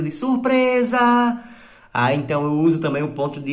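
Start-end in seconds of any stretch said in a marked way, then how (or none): 0.1-1.0 high, bright cry of surprise; surprise party shout. Shown as a demonstration. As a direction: shout it as the door opens. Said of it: "quando é um aniversário surpresa". (grito de festa surpresa, agudo)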